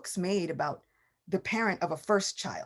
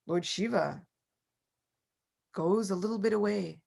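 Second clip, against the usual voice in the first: usual speaking voice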